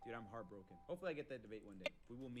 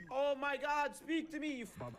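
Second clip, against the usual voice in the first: yelling; 14 semitones higher, 11 dB louder, slower than usual. Real, not acted. Yelling at rival.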